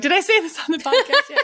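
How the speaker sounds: high, laughing self-quote; laughing question. Retold or imitated. Asking her co-host mid-story, laughing. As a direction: ask it through a laugh. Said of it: "I'm like".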